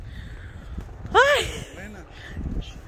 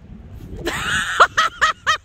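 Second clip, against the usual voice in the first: big laugh; 7 semitones higher than usual. Real, not acted. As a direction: burst out in a high cackling laugh. Laughing at the sledders' start.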